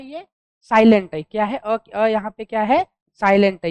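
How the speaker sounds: loud, stressing silent; calling out to the class loudly; loud teacher emphasis. Shown as a demonstration. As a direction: drive home that it is silent. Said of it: "stressing the silent letter".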